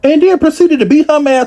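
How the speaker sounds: high, outraged retelling; outraged disbelief. Real, not acted. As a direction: tell it like you can't believe it. Outraged retelling, much higher.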